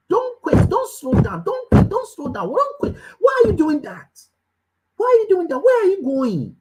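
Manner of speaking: high-pitched, insistent coaching plea; urgent exhortation. Real, not acted. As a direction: urge them on, high and driving. Coach urgently pleading with listeners not to quit.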